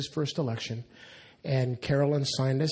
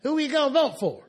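yelling back; 10 semitones higher than usual. Retold or imitated. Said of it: "she yells back".

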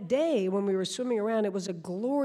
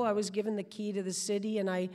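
usual speaking voice

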